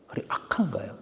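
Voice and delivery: hushed whisper for emphasis. Real, not acted. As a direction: drop to a whisper to drive it home. Preacher whispers for effect. (속삭이듯)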